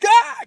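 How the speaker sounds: high, loud scream of fright; student shrieking at her boggart. Acted. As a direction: let out a terrified shriek. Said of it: "She's just screaming".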